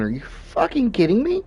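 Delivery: "Are you fucking kidding me?" pitched very high in a put-on voice; high, hammed-up incredulous cry; stunned disbelief. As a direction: squeal the disbelief at the rival's lap. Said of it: "I'm over here going".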